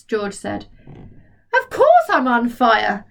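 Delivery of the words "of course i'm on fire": put-on slow, louder old woman; yelled. Grandma yells back at George, louder. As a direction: mildly yell it back. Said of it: "she yelled".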